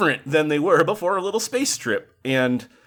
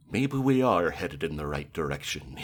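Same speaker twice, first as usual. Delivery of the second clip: put-on lowered, softer voice of a hopeful old person; 8 semitones lower, 5 dB quieter than usual. Guest imitating an old person reacting to South Park, quiet and low. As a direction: play it like a wistful old-timer, quietly. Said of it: "a weird old person thing".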